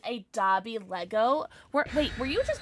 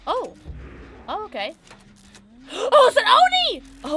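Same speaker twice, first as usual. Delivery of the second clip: high, loud, drawn-out exclamation; excited surprise; 8 semitones higher, 8 dB louder, slower than usual. Real, not acted. Streamer spotting the killer in game, startled and excited. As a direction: gasp and cry it out high.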